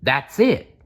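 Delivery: excited exclamation. Acted. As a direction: burst out with a bright new idea. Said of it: "Nicholas exclaimed".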